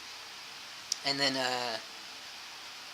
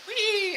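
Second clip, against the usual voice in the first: high, long mock squeal of glee; childlike "wee". Drunk man playing in the rain, high childlike squeal.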